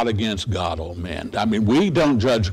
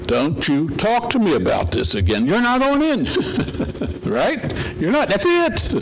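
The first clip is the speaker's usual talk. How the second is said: stern, rising to a high, sharp rebuke; an exasperated God cutting the talk short, rising sharply at the end; 7 semitones higher than usual. Retold or imitated. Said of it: "even God gets exasperated".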